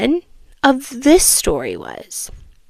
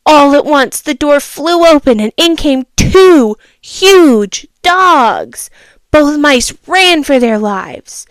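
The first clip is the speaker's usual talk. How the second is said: dramatic narration; 3 semitones higher, 7 dB louder, slower than usual. A narrator's reading. Dramatic dog entrance.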